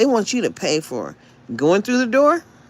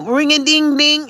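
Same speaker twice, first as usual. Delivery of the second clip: ringing phone imitation; 6 semitones higher, 6 dB louder than usual.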